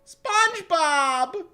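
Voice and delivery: high, long, loud cry; blurted cry. Acted. Character finding his friend, horrified high cry. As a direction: blurt the name out in a stretched shriek. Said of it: "finally got the word out".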